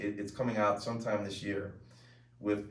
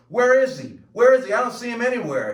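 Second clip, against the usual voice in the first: full-voiced, high acted shouting; intense emotional outburst; 13 semitones higher, 12 dB louder than usual. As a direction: shout it like a desperate movie scene. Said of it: "I'm in the middle of this, emo".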